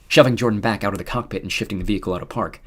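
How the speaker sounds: plain reading voice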